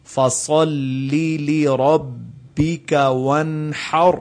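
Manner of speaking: slow chanted فَصَلِّ لِرَبِّكَ وَانْحَرْ; tajweed recitation. Shown as a demonstration. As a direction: recite like Quran, both lams clear. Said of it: "دو دفعہ لام آ رہا ہے".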